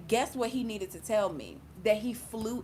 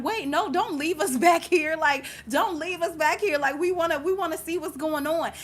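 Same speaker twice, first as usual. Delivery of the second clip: high, loud little voices begging; be the tiny people begging not to be left; pleading tiny people; 8 semitones higher, 6 dB louder than usual. Pretend. Voicing tiny people in closing book.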